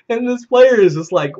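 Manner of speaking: usual speaking voice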